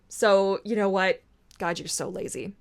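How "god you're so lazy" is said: inner critic. Pretend. Voicing her inner critic.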